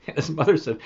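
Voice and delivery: ordinary delivery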